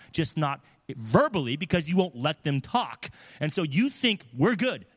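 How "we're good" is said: breezy mock "We're good", pitched up; dismissive. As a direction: brush it off like nothing happened. Avoider brushing off the conflict.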